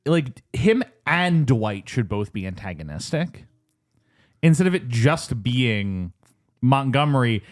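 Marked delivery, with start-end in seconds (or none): none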